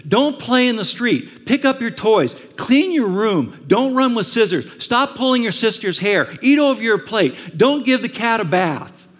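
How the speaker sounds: loud, high exasperated parent nagging. Retold or imitated. Parent scolding the kids over and over, exasperated and loud. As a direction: rattle off the rules like a fed-up parent. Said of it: "how many times do you have to tell your kids".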